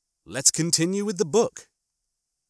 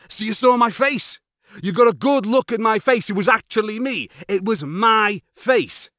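high, loud, urgent questioning; worked-up, slowing emphatically at the end; agitated insistence; 8 semitones higher, 5 dB louder than usual. A narrator's reading. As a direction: press for confirmation in growing dread. Lister presses Rimmer for confirmation.

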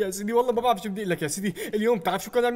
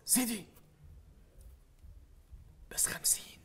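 whisper; 17 dB quieter than usual. Acted. Aide whispering the death toll to the boss, whisper. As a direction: breathe the number out.

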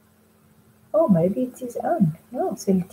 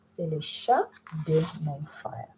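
quiet, much softer model repeat; 4 semitones lower, 11 dB quieter than usual. Shown as a demonstration. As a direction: repeat it gently for students. Model sentence repeated softly.

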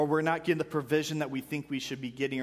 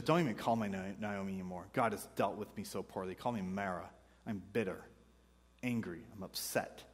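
softer, lower bitter lament; understated grief; bitter Naomi; 6 semitones lower, 6 dB quieter than usual. Retold or imitated. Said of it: "So she says".

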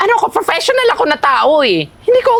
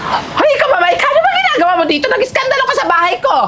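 high-pitched angry confrontation; indignant scolding; 6 semitones higher than usual. Acted. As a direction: scold the intruder making a scene. Homeowner confronts noisy intruder. (mataray na paninita)

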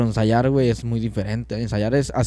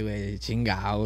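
normal talking voice

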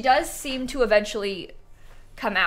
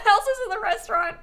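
higher, laughing through the words; 11 semitones higher, 5 dB louder than usual. Real, not acted. Player laughing at Elsa in the restaurant, laughing. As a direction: giggle it out, delighted.

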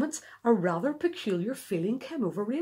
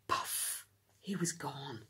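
whispered; 8 semitones lower, 11 dB quieter, slower than usual. A narrator's reading. Octopus vanishes in ink.

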